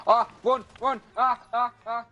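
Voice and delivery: high, loud yelps of alarm; panicked yelling. Real, not acted. Player flees gunfire in the game, panicked. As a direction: yell to flee, voice shooting way up. Under fire, fleeing in game.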